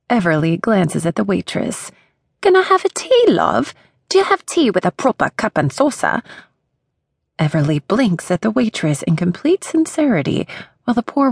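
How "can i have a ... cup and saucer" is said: a terrible put-on British accent, pitched high; bad British accent. Everly asks the waitress for tea in an awful fake British accent. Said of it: "the worst attempt at a British accent".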